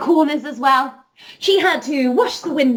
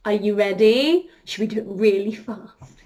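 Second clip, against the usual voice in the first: ordinary talk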